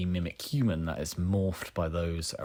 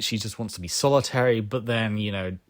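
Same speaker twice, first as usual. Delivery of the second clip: ordinary talk, nothing marked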